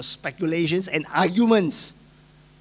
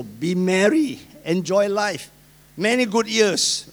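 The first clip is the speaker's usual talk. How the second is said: smug rich fool; 5 semitones higher, 6 dB louder, slower than usual. Retold or imitated. Rich fool gloats over harvest.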